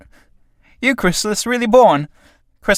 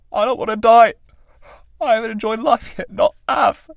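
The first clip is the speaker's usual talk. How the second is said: higher, strained pleading; desperate pleading; 6 semitones higher than usual. Acted. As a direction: beg for breath as you are strangled. Tramp begging death, strangled.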